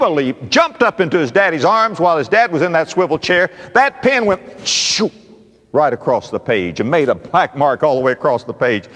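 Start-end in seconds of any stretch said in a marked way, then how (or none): 4.7-5.1 drawn-out high "shoo"; long rushing swish; imitated pen swoosh. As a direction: whoosh like a pen skidding across paper. Imitates pen streaking across page.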